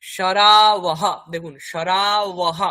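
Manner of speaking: সরাওহ dragged out very slowly, ব as w and a breathy -ah; semivowel w. Shown as a demonstration. Teacher modelling the Sanskrit word for bowl, very slow. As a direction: stretch each syllable, w glide in the middle. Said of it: "কারণ কি".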